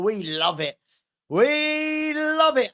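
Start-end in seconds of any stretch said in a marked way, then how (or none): 1.3-2.7 very high, stretched-out WE; drawn-out gleeful crow; gleeful celebration. Real, not acted. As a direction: hold WE long and high, then land on love it. Drawn-out celebration of caught troll.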